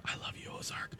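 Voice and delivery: breathy aside to the show; whispering. Real, not acted. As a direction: whisper it lovingly. Aside during nominee list.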